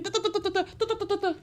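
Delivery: high, soft mocking du-du babble; mock chatter noise. Vlogger mocking a nagging woman, high babble. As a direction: imitate endless yapping.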